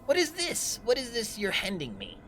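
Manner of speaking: high-pitched incredulous villain; high voice, coming down at the end; villain's questioning. Acted. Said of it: "He goes".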